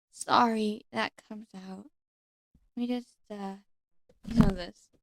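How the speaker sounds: soft, hesitant fairy in ASMR roleplay; gentle, halting fairy confiding softly; soft-spoken nature fairy. Acted. Fairy tells a visitor of her past, soft-spoken.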